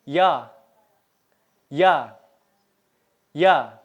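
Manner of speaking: "ya" for yağ, the final ğ left silent; silent soft g. Shown as a demonstration. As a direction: say "ya" with a drawn vowel and no g. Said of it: "Itu nggak dibaca ya". ("ya" dari yağ, tanpa bunyi g)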